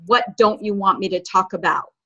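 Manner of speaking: normal talking voice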